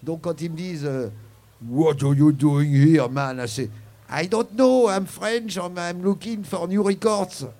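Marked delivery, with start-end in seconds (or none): 1.7-3.5 tough street challenge. Retold or imitated. Tough guys challenge him in Harlem. 4.1-7.5 put-on loud, high English with a thick French accent. A Frenchman answering Harlem guys in a thick French accent. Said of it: "tu leur réponds avec ton meilleur accent français".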